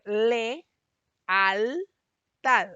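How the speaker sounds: le, al, tad: each syllable punched loud with a pause between; syllables split apart. Shown as a demonstration. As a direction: separate le-al-tad slowly, syllable by syllable. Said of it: "de separar lealtad". (sílabas separadas con pausas, le, al, tad)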